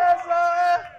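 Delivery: high, loud chant of S O S; shouted catchphrase. Acted. Wrestler shouting his catchphrase for the fans. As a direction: belt it out like a crowd chant.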